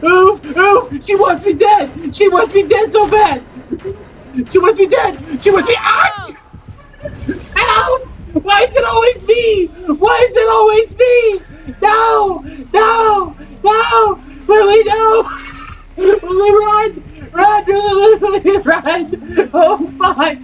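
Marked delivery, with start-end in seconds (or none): 0.0-14.2 high, frantic cries for help; panicked yelling. Real, not acted. Gamer fleeing the killer in panic, frantic yelling. 14.5-19.5 high urgent shout to a teammate; calling out. Real, not acted. Gamer warning teammate Lily to run, urgent shout.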